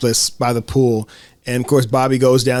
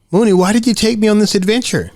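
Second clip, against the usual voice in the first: put-on pitched up, curious child; high kid voice; 8 semitones higher, faster than usual. Girl asking her friend under a tree, high and curious. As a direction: ask like a puzzled little girl. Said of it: "And Jancy says".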